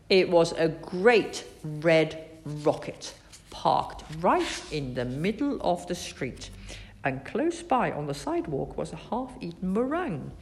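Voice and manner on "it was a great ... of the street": ordinary delivery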